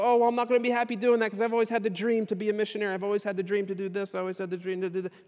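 high, rushed run of excuses; mock complaining. Mimicking a believer clinging to their own dream, mock complaining.

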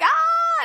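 sudden very high yell; high shout. Retold or imitated. Brother grabbing the toddler's head, sudden high yell. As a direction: let out a sharp high cry. Son grabbing the struggling child.

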